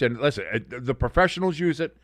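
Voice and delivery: ordinary talk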